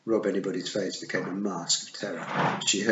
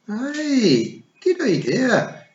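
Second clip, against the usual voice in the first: slow, higher Feegle drawl; slowly; 8 dB louder, much slower than usual. A narrator's reading. Rob Anybody agreeing slowly to Tiffany's plan, sly and slow. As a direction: stretch it out as a grin spreads. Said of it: "he said slowly".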